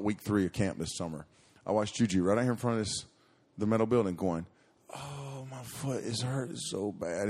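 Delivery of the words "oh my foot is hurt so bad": mock whiny child, slow and drawn out; fake whimper. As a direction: play the little girl faking pain. Child faking a hurt foot.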